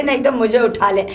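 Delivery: usual speaking voice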